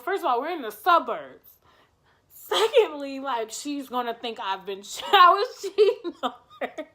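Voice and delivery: ordinary talk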